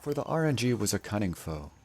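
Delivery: grand, measured fairy-tale narrator; epic story narration. Acted. Streamer narrating his game run as legend. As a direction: tell it like an ancient legend.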